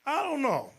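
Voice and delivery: high, puzzled mock husband; husband shrugging it off, high and unsure. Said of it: "He said".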